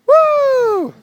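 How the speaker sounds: loud high cheer; excited cheer. Real, not acted. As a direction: cheer her on, full voice. Cheering the snow angel.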